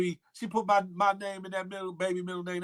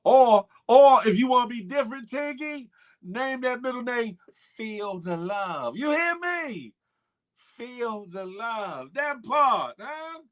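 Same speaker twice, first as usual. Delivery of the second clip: higher, louder hype with shouted asides; excited hype; 8 semitones higher, 6 dB louder than usual. Real, not acted. Hyping Tinky to name the baby Feel the Love, excited. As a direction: pitch it up and shout the tagline. Hyped naming suggestion to Tinky.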